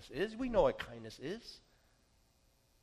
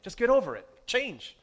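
louder, higher mock exasperation; impatient retort; 6 semitones higher, 7 dB louder than usual. Pastor to someone caught in sin, impatient mock retort. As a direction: imitate his old curt, no-patience self.

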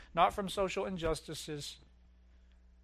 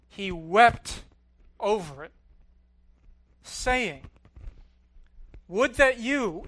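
lifted, solemn Bible reading; scripture reading; 4 semitones higher, 7 dB louder than usual. Acted. Preacher reading Jesus' lament from Luke: solemn reading. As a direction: read the passage out with weight.

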